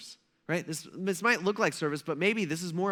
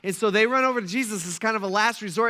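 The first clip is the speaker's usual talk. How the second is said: ordinary delivery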